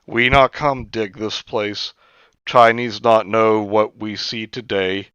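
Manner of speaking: choppy broken English, lightly voiced. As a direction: clip each word like a learner of English. [name] speaks in broken English.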